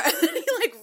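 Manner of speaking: talking through laughter, high and loud; laughing. Real, not acted. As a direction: crack up at your own melodrama. Laughing at own dramatic impression.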